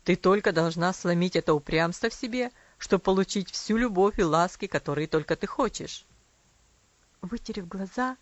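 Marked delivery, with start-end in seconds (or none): 0.0-6.1 stern father. A narrator's reading. Father voiced in story.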